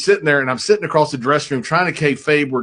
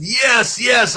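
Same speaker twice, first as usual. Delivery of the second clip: excited yes; 9 semitones higher than usual. Retold or imitated. Assuring Vader the spot is great.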